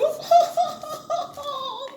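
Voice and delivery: squealing tearful laugh; high laugh-cry. Real, not acted. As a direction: laugh high through onion tears. Onion tears laughter.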